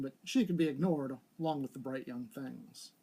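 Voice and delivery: plain reading voice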